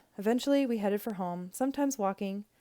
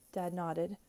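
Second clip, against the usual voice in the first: plain reading voice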